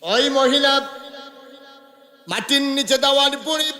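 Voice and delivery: high, loud chanted sermon in short bursts; full-force preaching. A narrator's reading. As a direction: hammer out each phrase high, pausing after it. (উঁচু সুরে জোরালো ওয়াজ)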